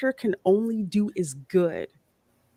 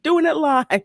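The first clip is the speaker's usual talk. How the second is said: higher laughing replay of the line; laughing quote; 9 semitones higher, 6 dB louder than usual. Retold or imitated. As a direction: laugh while repeating the clip. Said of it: "one of the best clips ever".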